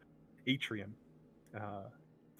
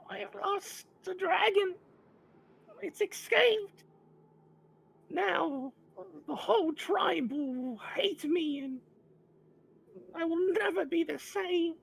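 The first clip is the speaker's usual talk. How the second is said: very high, loud creature voice, slow and weepy; heartbroken kobold; 7 dB louder, slower than usual. Acted. Kobold telling adventurers he lost the dragon, high weepy wail. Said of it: "expresses more and more of his, uh, heartsickness and his loss".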